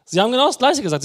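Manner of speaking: ordinary delivery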